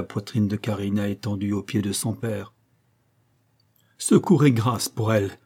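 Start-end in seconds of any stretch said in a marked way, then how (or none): 4.0-5.4 a touch of anguish, lightly pleading; understated heartbroken plea for her; heartrending cry. A narrator's reading. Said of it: "s'écria Memo d'un ton déchirant".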